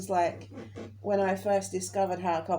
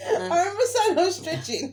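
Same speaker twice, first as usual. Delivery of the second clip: laughing; 9 semitones higher, 5 dB louder than usual. Real, not acted. Laughing at her own excuse.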